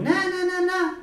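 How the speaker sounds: high thin cartoon squeak; squeaky mouse voice. Shown as a demonstration. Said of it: "Geralmente ele vai falar fininho". (voz fininha de ratinho, bem aguda)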